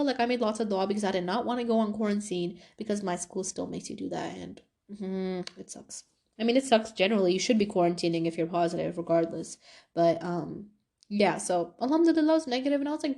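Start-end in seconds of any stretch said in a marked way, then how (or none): none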